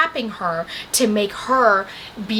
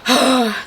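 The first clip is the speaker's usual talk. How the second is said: loud, weary huff; exasperated sigh; 3 semitones higher, 4 dB louder than usual. Real, not acted. Vlogger wanting to shake the character, exasperated sigh. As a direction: breathe out in frustration.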